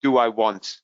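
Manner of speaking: normal talking voice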